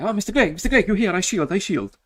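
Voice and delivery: put-on very high, loud Italian accent, gushing; Italian restaurant owner. Italian owner greeting his favourite customer, high Italian accent.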